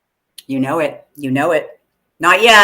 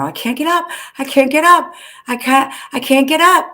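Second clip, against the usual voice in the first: high-pitched imitated cry for help; whimpering victim; mock victim wail; 7 semitones higher than usual. Voicing Marge in horror movie.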